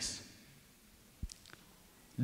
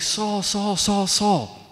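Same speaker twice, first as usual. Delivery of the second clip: higher-pitched, urgent repeated call; 6 semitones higher, 4 dB louder, much slower than usual. Acted. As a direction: call after someone to get their attention. David running, calling to Saul.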